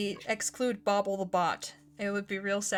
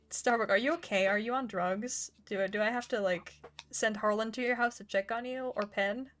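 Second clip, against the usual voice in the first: ordinary talk, nothing marked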